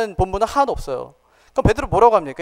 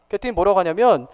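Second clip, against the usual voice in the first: ordinary talk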